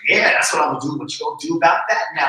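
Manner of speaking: loud, fast mock taunt from the phone. The phone mocking her mid-reading, loud and fast taunt. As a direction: sneer like the phone is daring you.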